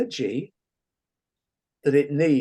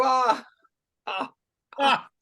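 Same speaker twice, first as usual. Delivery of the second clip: high "Ah!" laugh, much higher, trailing off; 9 semitones higher, slower than usual. Shown as a demonstration. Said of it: "We're gonna go".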